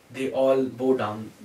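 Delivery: plain reading voice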